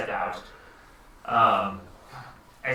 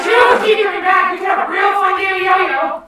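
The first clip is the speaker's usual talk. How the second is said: shouted, very high squeaky voice; loud high-pitched little creature at full volume; 11 dB louder than usual. Acted. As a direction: shriek it as a tiny excitable character. Little G excited about being thrown.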